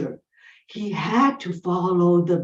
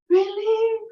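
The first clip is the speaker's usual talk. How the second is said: hopeful surprise; 11 semitones higher than usual. Acted. Hopeful mother in story.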